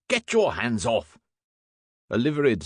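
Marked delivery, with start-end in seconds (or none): 0.1-1.2 indignant, higher-pitched shout. A narrator's reading. Inspector pinned by two constables, shouting indignantly. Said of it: "shouted Field".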